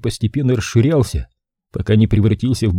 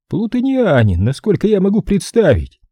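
higher lightly amused voice; handler voice over radio; 10 semitones higher than usual. Acted. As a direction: answer with dry humor. Crowley answers over radio.

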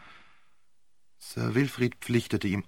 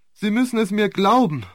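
higher, pleading harder, stress on glauben; second plea pushed higher; more desperate plea; 9 semitones higher, 5 dB louder than usual. A narrator's reading. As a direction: lean into glauben, begging. Repeated plea, building.

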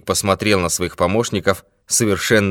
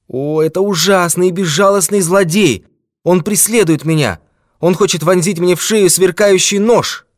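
high, loud cry of dread; terrified; 13 semitones higher, 8 dB louder than usual. A narrator's reading. Old man describing his persecutor to the detective, terrified. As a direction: pour out the terror at high pitch.

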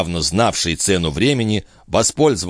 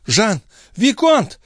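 high alarmed calls; crying out; slower than usual. A narrator's reading. Chon calls the viscount from the carriage, crying out. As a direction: call out high, climbing on the second word. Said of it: "кричала мадемуазель Шон".

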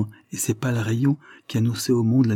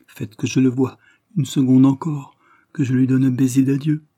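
louder, higher, urgent entreaty; pleading; 4 semitones higher, 6 dB louder than usual. A narrator's reading. Daughter begging to see her dying father, pleading. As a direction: beg with the voice lifted.

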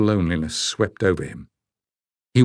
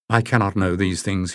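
ordinary delivery, nothing marked

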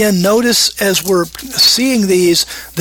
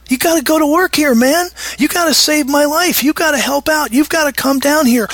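higher, fast begging prayer; desperate plea to God; 8 semitones higher than usual. Retold or imitated. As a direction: beg God to act, urgently. Acts the psalmist begging God.

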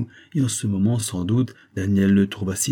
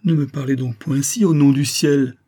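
higher, louder pleading, with only a touch of tears; tearful plea; 6 semitones higher, 5 dB louder than usual. A narrator's reading. Paul begs her not to speak so, mildly tearful plea. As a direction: beg her emphatically, mildly distraught. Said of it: "eut subitement des sanglots dans la voix".

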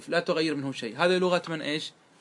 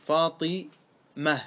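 "فاطمة" said slowly with a pause before the last syllable; drawn-out "فاطمة", final syllable set apart; split word; much slower than usual. Shown as a demonstration. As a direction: break "فاطمة" into fati, then mah. Word split to show dropped ending.

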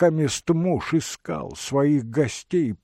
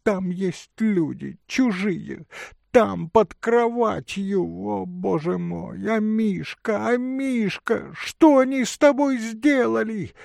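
distraught lady; 9 semitones higher than usual. Acted. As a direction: lament in a high, alarmed voice. Дама в истерике над собачкой. (высокий причитающий голос дамы)

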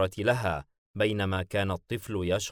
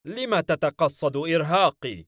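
higher, louder accusation; angry; 7 semitones higher, 5 dB louder than usual. A narrator's reading. Shoved demon accusing his comrade, angry. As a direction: fire the question back in anger. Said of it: "بيلفيغور مناظرا حاييم بغضب".

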